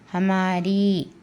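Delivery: हमारी drawn out slowly, every syllable clear; slow demo. Shown as a demonstration. Teacher showing students how to say हमारी, slowly drawn out. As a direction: stretch हमारी out for the class. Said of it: "इस तरीके से".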